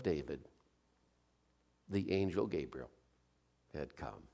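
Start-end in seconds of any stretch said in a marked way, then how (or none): none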